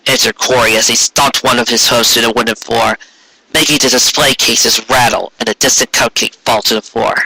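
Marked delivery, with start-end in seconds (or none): none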